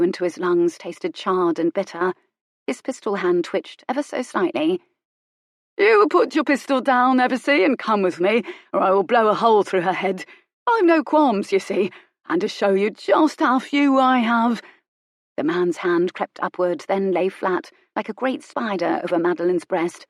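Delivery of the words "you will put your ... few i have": high, loud, greedy thug's voice; gloating villain. Acted. Thug gloating over catching Colin, high and loud. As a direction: crow over the captive like a lucky brute.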